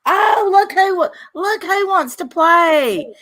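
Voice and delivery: high-pitched, gleeful teasing; giddy. Retold or imitated. Streamer recalls spotting her rival arrive, giddy. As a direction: stretch it out high, mock-delighted. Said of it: "I got a little giddy".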